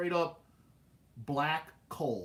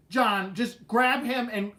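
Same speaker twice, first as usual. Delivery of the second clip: louder, higher, drawn-out mom's command; exasperated order; 5 semitones higher, 6 dB louder, slower than usual. Retold or imitated. Mom telling dad to grab the kid, exasperated. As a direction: imitate a fed-up mom giving orders.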